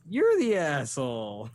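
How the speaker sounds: slow, stretched-out mock retort; Kevin Smith. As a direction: draw it out as a belated comeback. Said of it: "Kevin's like".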